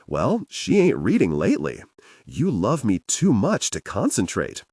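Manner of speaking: usual speaking voice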